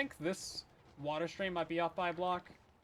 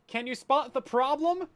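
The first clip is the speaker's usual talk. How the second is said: higher, louder teasing question; excited quiz; 8 semitones higher, 7 dB louder than usual. Acted. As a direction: push the question up, playful. Asking viewers to spot issue.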